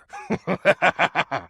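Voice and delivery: high, gleeful dark chuckle; smug evil laugh; amused villain laugh. Acted. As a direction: laugh down at the mortal.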